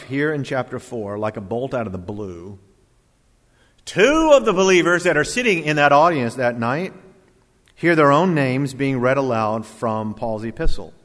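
ordinary delivery

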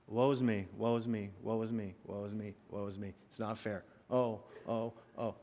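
soft, fading woe-is-me moping; mock self-pity. Preacher acting out worldly sorrow stuck in place, mock self-pity. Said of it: "That's worldly sorrow".

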